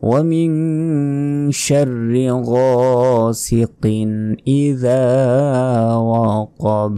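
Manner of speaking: slow, sung Quran recitation. Shown as a demonstration.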